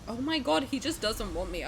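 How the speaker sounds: ordinary delivery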